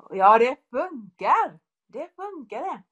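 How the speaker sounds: högt och segerglatt; jublande utrop. Real, not acted. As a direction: ropa ut glädjen. Exclaims joyfully after quote. (talaren jublar över bönesvaret)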